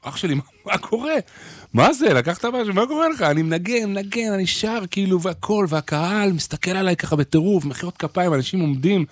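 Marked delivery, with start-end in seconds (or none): 0.0-3.2 imitating the guitarist, pitched higher; mock amazed bandmate; guitarist asking. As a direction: quizzical guitarist, voice climbing in disbelief. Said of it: "אומר לי".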